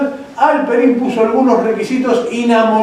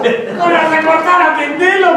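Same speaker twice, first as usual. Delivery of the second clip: high, slow insistent wife's voice; wife's order; 10 semitones higher than usual. Retold or imitated. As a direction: play the wife putting her foot down. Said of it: "me dijo".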